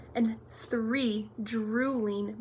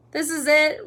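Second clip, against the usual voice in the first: loud, higher-pitched wail of despair; moaned; 5 semitones higher, 8 dB louder than usual. A narrator's reading. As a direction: moan as if all is lost. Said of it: "Ron moaned".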